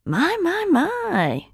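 high, sing-song mock amazement; teasing. A narrator's reading. Friend goads drenched, flustered Holly, teasing. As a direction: tut at her in high-pitched mock wonder.

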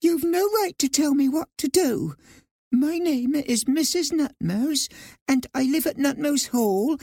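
indignant high little mouse; trying to sound firm. A narrator's reading. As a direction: protest primly in a high small voice. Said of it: "trying to sound firm".

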